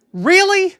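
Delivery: high, loud disbelief; incredulous. Real, not acted. Fan reacting to a wrestler's excuse, incredulous.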